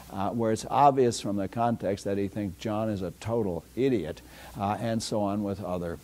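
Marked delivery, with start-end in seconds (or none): none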